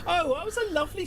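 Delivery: very high falsetto, sweetly mocking. Character cooing over the story in a high falsetto.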